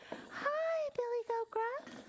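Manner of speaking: very high, tiny mock troll voice; squeaky trial troll voice. Shown as a demonstration. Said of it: "Should I have this kind of voice".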